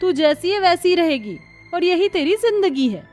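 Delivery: high-pitched scornful jeering; mocking taunt. Sister-in-law mocking Kanchan's new worship, scornful.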